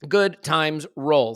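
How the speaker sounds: slow, stretched-out good times roll; drawled catchphrase. Acted. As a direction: stretch it out lazily.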